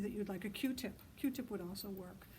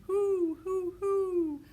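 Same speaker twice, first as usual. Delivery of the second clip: high, loud owl calls; loud owl hoot; 10 semitones higher, 11 dB louder, much slower than usual. A narrator's reading. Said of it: "and they were shouting".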